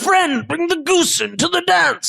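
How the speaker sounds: high-pitched, exasperated chef; urging chef. Acted. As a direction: push the kitchen help along as the chef. Chef urges helper along.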